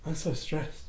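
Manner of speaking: mildly acted stressed-out person; role-played stress. Teacher demonstrating a stressed person for students, role-played stress. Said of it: "I'm role playing".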